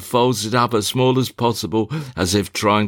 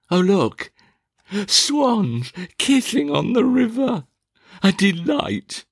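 delighted; 11 semitones higher than usual. Acted. Alicia delighted by swans.

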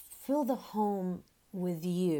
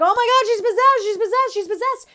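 much higher, much louder hysterical screaming; panicked shrieking; 15 dB louder than usual. Retold or imitated. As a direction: shriek it like terrified teenage girls. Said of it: "everyone's like".

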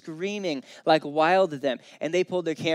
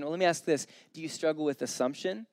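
ordinary talk

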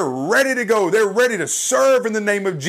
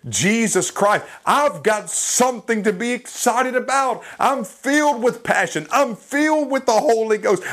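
impassioned, rolling testimony in a raised pitch; fervent preaching; 4 semitones higher than usual. Real, not acted. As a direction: fire back with driving, repeated declarations. Preaching at full force.

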